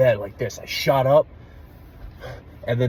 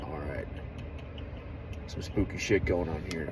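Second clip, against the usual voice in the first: low, softer mock unease; hushed spooked; 5 semitones lower, 11 dB quieter than usual. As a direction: say it under your breath, spooked. Retold spooked remark.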